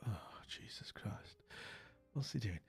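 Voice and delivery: hushed British cringe; muttered dismay. Retold or imitated. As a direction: groan it under your breath. Unimpressed Brits' muttered reaction to whoops.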